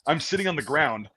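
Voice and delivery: ordinary delivery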